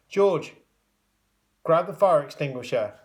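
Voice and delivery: loud, urgent order; shout. A narrator's reading. Porter ordering George to grab the extinguisher, shouting. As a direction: bark it at George. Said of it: "shouted the porter".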